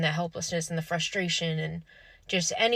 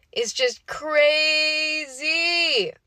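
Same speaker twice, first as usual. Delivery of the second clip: "crazy" stretched long, high and loud; exasperated disbelief; 9 semitones higher, 5 dB louder, much slower than usual. Real, not acted. Overwhelmed disbelief at the gaslighting.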